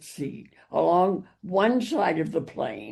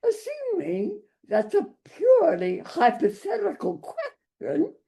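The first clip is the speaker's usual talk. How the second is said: much higher, snooty European elite lady; 13 semitones higher than usual. Acted. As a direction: look down your nose, pitch way up. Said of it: "the high-pitched, snooty voice of the European elite".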